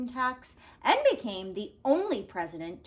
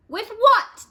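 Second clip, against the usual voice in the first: alarmed question; 12 semitones higher, 11 dB louder than usual. Acted.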